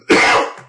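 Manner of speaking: loud dusty cough; short, explosive old shopkeeper cough; old gnome's cough. Acted. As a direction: hack up a wheeze of dust. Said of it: "just kinda coughs and".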